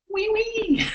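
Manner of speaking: squeaky mimicked French with London in it; very high teasing French imitation; mock French-South London accent. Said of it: "like, a French South London".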